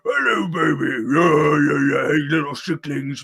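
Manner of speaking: booming tipsy uncle; loud, rowdy uncle voice. Pretend. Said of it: "I can even do my, my uncle voice".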